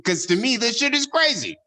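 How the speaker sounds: a child laughing as it mocks the girl's accent; teasing kid voice. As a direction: play your cheeky kid self, giggling. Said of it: "I'm like".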